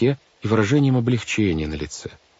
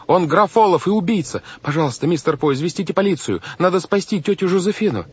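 high-pitched, urgent accusation; crying out; 11 semitones higher than usual. A narrator's reading. Boy begs the banker to call police, crying out. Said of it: "закричал Клаус".

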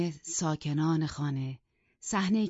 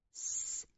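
a sharp whispered shh; hushing sound; 8 dB quieter than usual. A narrator's reading. Maid hushing the master, whispered shh. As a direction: shush him quickly.

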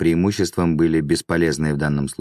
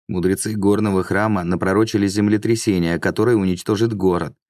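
plain reading voice

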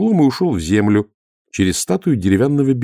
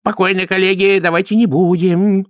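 high-pitched pleading for restraint; admonishing appeal; 11 semitones higher, 4 dB louder than usual. A narrator's reading. High priest appeals to fellow clergy, admonishing. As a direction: urge them to settle down, high-voiced. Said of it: "увещевающе обратился главный жрец".

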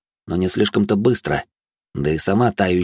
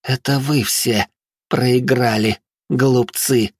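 hoarse voice; 4 semitones higher, much slower than usual. Acted. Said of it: "прохрипела".

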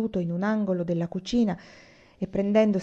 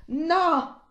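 high, drawn-out refusal; angry cry; 10 semitones higher than usual. A narrator's reading. Anania's anger grows in the kitchen, crying out. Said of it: "il suo grido echeggiò nuovamente".

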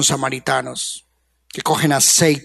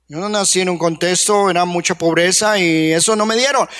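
usual speaking voice

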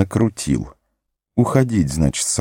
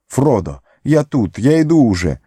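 shouting while running; 7 semitones higher than usual. Acted. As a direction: yell after the boat. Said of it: "кричал он с разбегу".

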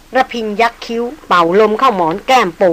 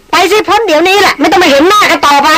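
high, louder shrill dismissal; shrill yell; 9 semitones higher, 3 dB louder than usual. Acted. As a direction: screech at him to leave. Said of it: "ดารินตวาดเสียงแหลมจัด". (ตวาดเสียงแหลมจัด)

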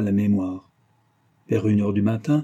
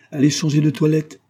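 louder, higher commanding tone; imperious; 6 semitones higher, 5 dB louder than usual. A narrator's reading. Husband dismissing his wife from the nursery, imperious. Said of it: "dit-il d'un ton impérieux".

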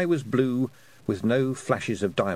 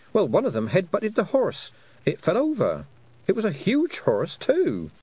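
a higher, eager girl's voice, lightly voiced; young girl Tiffany; 10 semitones higher, 3 dB louder than usual. Tiffany telling the toad her troubles, higher and eager. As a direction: sound like a flustered young girl.

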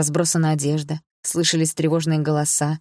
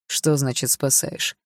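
usual speaking voice

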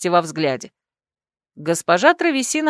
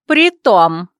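a sharp, louder retort; raised voice; 6 dB louder than usual. A narrator's reading. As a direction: cut in forcefully. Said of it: "повысила голос оборотниха".

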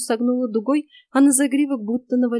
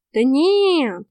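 higher, loud, stretched dismissal; dismissive cat; 4 semitones higher, 5 dB louder than usual. A narrator's reading. Cat brushes off the heroine's guess, drawling. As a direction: wave it away with a long cat drawl.

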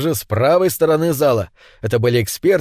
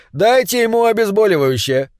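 commanding; 6 semitones higher than usual. Acted. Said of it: "скомандовал ведущий".

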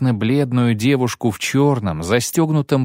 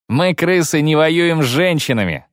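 higher mocking echo, teeth bared; snarling grin; 6 semitones higher than usual. Kayley mocking Giseler's words to the noblewomen, sneering. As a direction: throw his words back with a sneer. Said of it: "снова ощерился Кайлей".

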